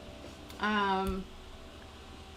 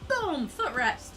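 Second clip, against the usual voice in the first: higher, louder, gushing about a discovery; real giddy excitement; excited; 7 semitones higher, 5 dB louder than usual. Real, not acted. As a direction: burst out with delight over a footrest. Thrilled about chair footrest.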